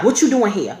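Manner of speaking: imitating mom's stern questioning; mock parental interrogation; stern mother. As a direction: put her on the spot like a strict mother. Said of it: "this stern ass voice".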